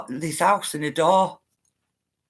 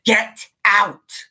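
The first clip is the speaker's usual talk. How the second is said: a hint of a louder demon order; demonic voice; 4 dB louder than usual. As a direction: voice the demon telling her to leave. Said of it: "The voice said".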